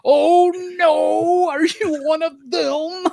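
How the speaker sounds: high, slow mock American accent done by a Brit playing a German; gasping American accent in a German villain's mouth; fake American accent. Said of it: "a British actor doing a German trying to do an American accent".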